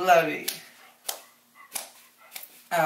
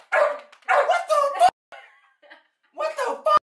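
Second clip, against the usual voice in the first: shocked disbelief. Real, not acted. Dog bites him instead.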